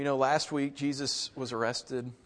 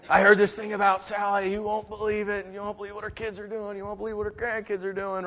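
gossipy churchgoer; 7 semitones higher than usual. Retold or imitated.

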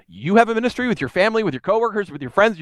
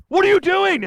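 high, louder fake-shocked question; teacher playing shocked at the candy-eating teens; mock surprise; 11 semitones higher, 3 dB louder than usual. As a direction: catch them in the act. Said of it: "finally go".

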